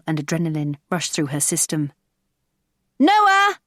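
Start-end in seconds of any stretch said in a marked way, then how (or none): 3.0-3.6 loud, high call over the noise. A narrator's reading. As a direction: shout his name to be heard. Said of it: "her voice struggling to be heard".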